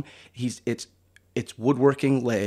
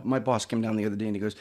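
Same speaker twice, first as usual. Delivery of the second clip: usual speaking voice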